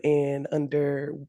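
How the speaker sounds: normal talking voice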